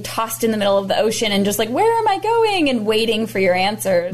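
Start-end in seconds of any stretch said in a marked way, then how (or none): 1.7-2.7 swooping high, playfully lost; mock lost bewilderment. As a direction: act out being adrift and clueless. Voicing someone lost at sea.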